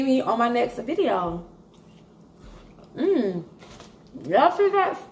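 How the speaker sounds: talking with food in mouth; mumbled between bites; chewing while talking. Real, not acted. Seen 1: Bites sandwich just before, mouth closed and working while holding it.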